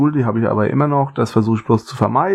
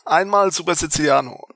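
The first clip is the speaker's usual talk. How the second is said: pizza delivery guy; 7 semitones higher than usual. Pretend. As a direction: play the cheery pizza man. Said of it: "unserem Pizzaboten". (übertriebener Pizzabote)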